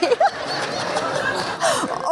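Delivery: high-pitched helpless giggle; real high giggling fit; high squealing laugh. Real, not acted. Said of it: "Oh my goodness".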